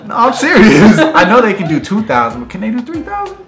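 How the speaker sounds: excited hype. Real, not acted. Hyping viewers for likes.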